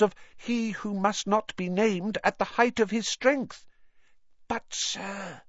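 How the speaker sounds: high, pleading little elf; squeaky anxious house elf. Acted. Dobby the elf speaking.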